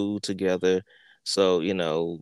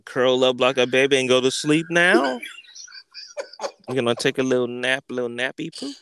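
mock babying; 3 semitones higher, 4 dB louder than usual. Mocks friend lying down like baby.

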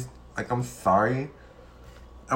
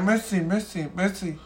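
mock French; 6 semitones higher, 4 dB quieter than usual. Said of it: "I be like".

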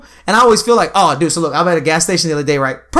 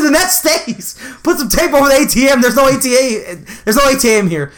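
retold angry rant; 7 semitones higher than usual. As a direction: yell it like you're reliving the outrage. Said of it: "my rant about this A T M; how mad I was".

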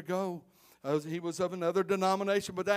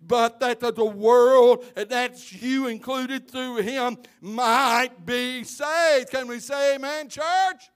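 louder, higher, rising to a ringing call; preaching at full force; 4 semitones higher, 6 dB louder than usual. Real, not acted. Preacher urging his church, preaching at full force. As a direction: drive the gospel home to the church.

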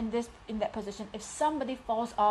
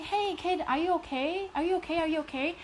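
caring adult; 7 semitones higher than usual. Pretend. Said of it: "you will go and say".

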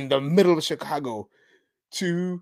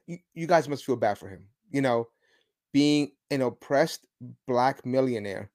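ordinary talk

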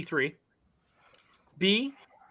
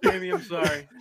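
laughing; 13 semitones higher, 6 dB louder than usual. Real, not acted. Laughing apology after joke.

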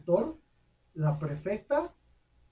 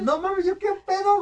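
mock outraged disbelief; 14 semitones higher, 6 dB louder than usual. Retold shocked reaction.

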